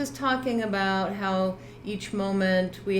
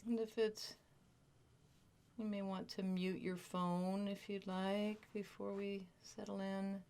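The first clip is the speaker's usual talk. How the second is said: guided meditation; 2 semitones lower, 12 dB quieter than usual. Real, not acted. Teacher settling a group into body awareness, guided meditation. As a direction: gently lead listeners into stillness, leaving space between phrases.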